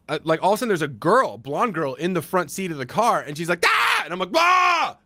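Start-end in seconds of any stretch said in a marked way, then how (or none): 3.7-4.0 high sharp shriek of fright; startled scream. Retold or imitated. Actress finds a stranger opening her car, high scream. 4.4-5.0 loud high yelp of surprise; shocked yell. Retold or imitated. Startled man screams back at the woman in the car, loud yelp.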